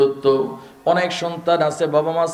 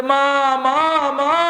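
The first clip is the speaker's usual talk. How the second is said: higher, sweet calls of mother; sweet tender voice; 10 semitones higher, much slower than usual. Shown as a demonstration. Preacher showing the sweet way to call mother, tender. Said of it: "মিষ্টি মধুর কণ্ঠ দিয়ে".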